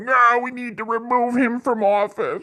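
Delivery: high mocking whiny voice; high mocking voice of a crybaby liberal. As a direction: sneer out a crybaby liberal's complaint. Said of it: "Instead of crying and being like".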